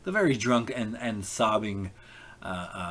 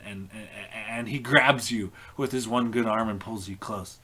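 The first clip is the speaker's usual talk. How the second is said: ordinary talk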